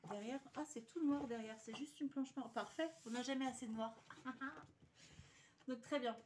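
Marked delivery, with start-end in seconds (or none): 4.0-5.4 short airy chuckle; soft, breathy giggle; laugh. Real, not acted.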